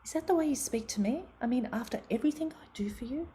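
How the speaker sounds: high, indignant mother, the second line fast and hissed; a hurt parent scolding her teen; mother rebuking a rude teenage daughter, high then whispered; offended mother. Retold or imitated. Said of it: "And I say".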